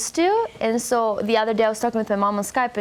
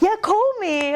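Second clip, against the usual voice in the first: much higher playful mock line; gesture-talk quote; 12 semitones higher, slower than usual. Speaker voicing a call-me hand gesture, pitched way up. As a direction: toss it off brightly like a phone-hand sign. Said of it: "Or it's like".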